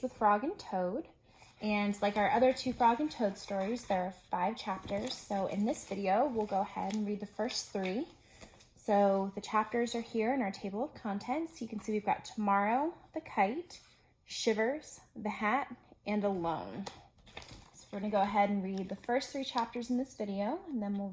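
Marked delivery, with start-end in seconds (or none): none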